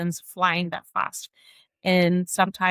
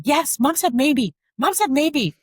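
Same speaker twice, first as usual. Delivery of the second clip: higher, slow firm big-sibling voice; older sibling repeating the rule; sibling scolding; 9 semitones higher, 3 dB louder, slower than usual. Retold or imitated. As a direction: lecture the little brother slowly. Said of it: "And that's like".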